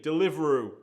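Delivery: ordinary delivery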